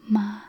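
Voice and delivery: "ma" held on one level pitch; first tone. Shown as a demonstration. Teacher showing Mandarin first tone, level "ma". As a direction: keep "ma" steady and even. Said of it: "the first tone".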